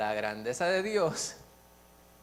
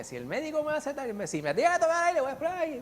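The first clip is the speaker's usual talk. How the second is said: high, loud griping in her voice; mock ranting patient; 13 semitones higher, 4 dB louder than usual. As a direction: rant like the furious sick woman. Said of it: "ella por aquella boca despotricó".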